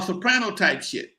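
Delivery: ordinary talk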